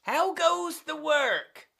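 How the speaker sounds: high, drawn-out call; singsong farmer's holler, pitched high. Acted. Farmer calls to duck repeatedly.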